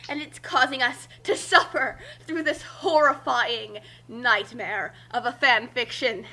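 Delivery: usual speaking voice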